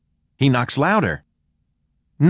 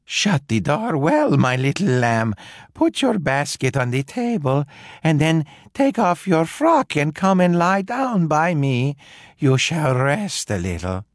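a hint of a sweet granny, a touch higher; coaxing disguised wolf; 6 semitones higher than usual. A narrator's reading. Wolf in granny's bed coaxing the girl closer. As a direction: lure the child in gently.

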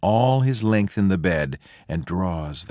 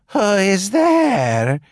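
high, slow put-on gentle voice; a wolf faking a kindly granny; softened wolf voice; 11 semitones higher, 5 dB louder, much slower than usual. A narrator's reading. Wolf at the door disguising himself to the girl, high and slow. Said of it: "softening his rough voice as best he can".

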